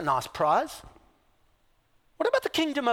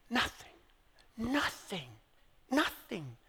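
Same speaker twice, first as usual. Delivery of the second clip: hushed; 7 dB quieter than usual. Acted. Hushed repetition.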